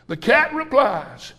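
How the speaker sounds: ordinary talk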